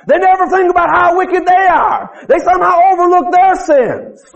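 high-pitched, driving pulpit delivery; forceful preaching. Real, not acted. Preacher telling congregation how the wicked excuse their sins, forceful.

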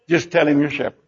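low, offhand aside after a big promise; casual instruction. Acted. Joseph coaching his family for Pharaoh, casual and low. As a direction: drop down and toss it off casually.

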